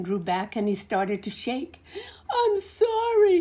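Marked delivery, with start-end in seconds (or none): 2.3-3.4 high, loud, crying apology; sputtering. Acted. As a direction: stammer it out, shaking with fear. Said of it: "he sputtered".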